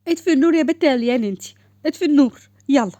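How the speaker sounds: sibling squabble at bedtime, insistent; annoyed order. Acted. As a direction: boss her sister around irritably. Sisters bickering over light.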